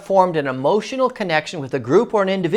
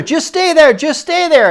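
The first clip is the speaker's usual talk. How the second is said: high, loud call across the mud; calling out to her across the field; shouted instruction; 10 semitones higher, 5 dB louder than usual. Retold or imitated. Family calling to grandma stuck in mud, raised shout.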